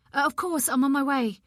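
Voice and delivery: quick, alarmed reply; urgent and rattled. Acted. Rushing to hospital news.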